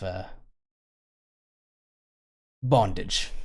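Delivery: slippery rogue pleased with himself, only lightly put on; cocky smuggler who just broke out of his cell; smuggler NPC.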